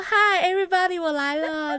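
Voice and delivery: very high, loud showy greeting; big-star entrance. Retold or imitated. Greeting a department that is all watching, big-star exuberance. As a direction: announce your arrival to an adoring crowd. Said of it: "我就很像个大明星一样".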